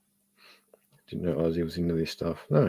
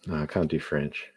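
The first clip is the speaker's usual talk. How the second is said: ordinary talk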